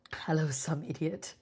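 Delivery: teasing whisper. Real, not acted. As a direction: whisper the name playfully. Greets viewer named some idiot.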